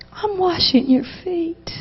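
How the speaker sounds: softly, almost under the breath; gentle quiet voice of Jesus. Retold or imitated. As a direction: speak as a tender Lord kneeling at her feet. Jesus quoted in a vision.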